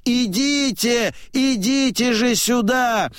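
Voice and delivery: high-pitched summons; old man's high calling; crying out. A narrator's reading. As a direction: call them over in a high, ringing voice. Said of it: "как старик кричит".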